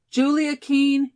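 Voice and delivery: slow, high, loud full-name scolding; drawn-out, insistent reproach; stern reproach. A narrator's reading. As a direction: call her to account by name. Molly scolds her evasive friend.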